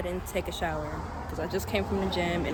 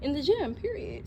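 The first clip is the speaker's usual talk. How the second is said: sassy emphasis; 7 semitones higher than usual. Real, not acted.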